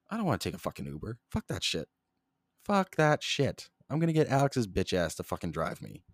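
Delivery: normal talking voice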